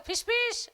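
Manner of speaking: high, sharp shoo sound; shooing noise. Acted. Seated husband driving off the cat, high shooing. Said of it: "हे लांबूनच म्हणायला लागलं".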